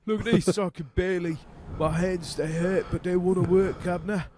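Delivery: put-on Cockney accent. Podcaster shows a cohost his exaggerated Cockney accent. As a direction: play a chirpy Cockney in a Guy Ritchie movie. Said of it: "Cockney Lou".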